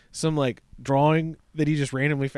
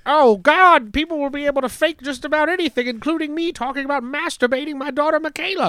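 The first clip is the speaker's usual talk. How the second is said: high, panicky mock fear; pitched up in fake panic; mock terrified; 10 semitones higher than usual. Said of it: "terrified by Chat G P T".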